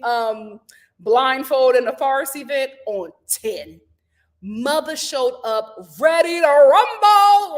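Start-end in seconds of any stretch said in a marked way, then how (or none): 4.4-7.6 loud, drawn-out ring-announcer hype; hyped announcer style. Acted. Recapper hyping Teresa's arrival to viewers, loud and drawn out. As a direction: stretch it out like a fight announcer.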